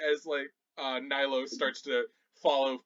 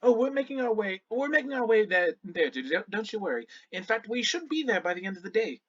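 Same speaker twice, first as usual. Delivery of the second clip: higher, easygoing reassurance; lightly voiced Shannara, reassuring; 7 semitones higher than usual. Shannara telling a passenger not to worry, reassuring. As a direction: soothe the worried traveller, pitched up a little.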